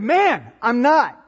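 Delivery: loud, high-pitched acted retort; indignant denial. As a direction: push the accusation away emphatically. Acting Peter's second denial.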